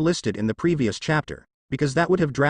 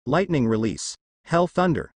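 plain reading voice

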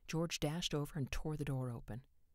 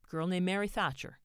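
bright, loud, higher cheerful answer; 5 semitones higher, 7 dB louder than usual. A narrator's reading. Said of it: "Silva said cheerfully".

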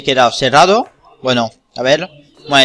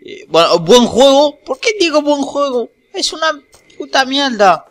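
mocking squeaky voice; 11 semitones higher than usual. Sarcastic high-pitched mock praise.

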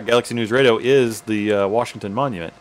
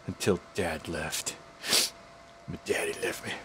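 lower, softer mock-sad whimper over dad leaving; 5 semitones lower, 14 dB quieter than usual. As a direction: ham up a pitiful abandoned child, quietly. Reading a sad dialogue option.

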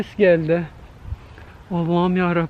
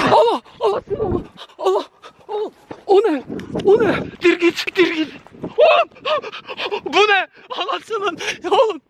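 terrified screaming; 12 semitones higher than usual. Real, not acted. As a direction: scream in real terror as something chases you. Something runs at him in park. (korku içinde çığlık çığlığa)

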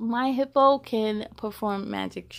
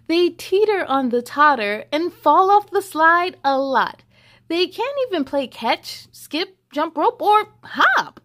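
put-on lively read-aloud to children; story reading; 5 semitones higher, 7 dB louder than usual. A narrator's reading. Reading the hippo book to children, lively storybook voice. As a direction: read it bright and sing-song for kids.

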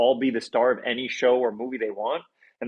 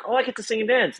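excited quote; 10 semitones higher, faster than usual. Retold or imitated. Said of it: "I was so excited and so terrified".

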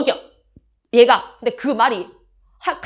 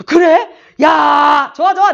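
높고 크게 기뻐하는 외침; 반가운 시아버지; 4 semitones higher, 10 dB louder, slower than usual. Retold or imitated. 시아버지가 반가워함. (사돈이 온다는 말에 신난 시아버지)

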